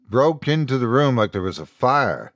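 ordinary delivery, nothing marked

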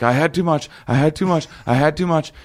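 high, looping mock panic; retold freak-out. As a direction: imitate a friend panicking over and over. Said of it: "freaking out for like, a minute and a half".

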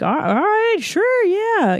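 high, naive little yes; naive agreement. Retold or imitated. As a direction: play the gullible girl going along. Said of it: "naive Christina".

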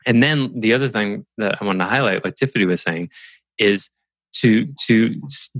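normal talking voice

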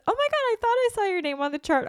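high, fast, loud excited gasp; surprised friend. Retold or imitated. Friend spots her name on the chart, high excited gush.

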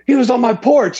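high, agitated imitation of a homeowner; freaking out. Homeowner finding a lion was on his porch, freaking out. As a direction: act out his panic, pitch leaping up. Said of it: "He starts freaking out".

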